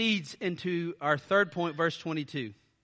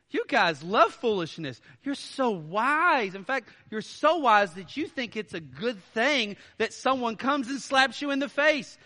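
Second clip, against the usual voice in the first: higher, mock-admiring sarcasm; Paul mocking the Corinthians; biting sarcasm; 6 semitones higher, 4 dB louder than usual. Shown as a demonstration. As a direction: stretch so wise with scorn. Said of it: "He's satirically, sarcasm, saying".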